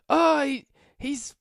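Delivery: very high, loud panicked wail; hysterical accuser. Acted. Thrown man accusing the Minotaur to the crowd, shrieking high. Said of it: "he goes".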